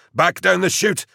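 loud, high-pitched command under fire; urgent retreat order. A narrator's reading. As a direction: call the men back in alarm.

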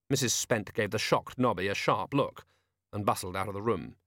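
ordinary delivery

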